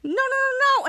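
high string of mock objections; high-pitched protest. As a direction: imitate kids crying out to stop him. Kids protesting in retold scene.

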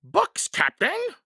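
high, slow, loud henchman voice; shouting Pinky. A narrator's reading. A pirate reports from the treehouse to his captain, shouting. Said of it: "Pinky shouted down".

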